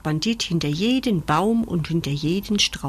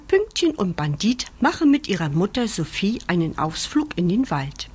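plain reading voice

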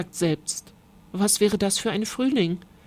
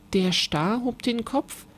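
ordinary delivery, nothing marked

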